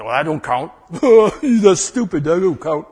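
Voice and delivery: loud, sneering devil taunt with a laugh; jeering enemy voice, loud and high; mocking enemy voice. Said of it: "the enemy will come along and say".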